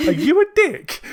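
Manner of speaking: innocent question. Retold or imitated. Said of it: "the way that line is delivered".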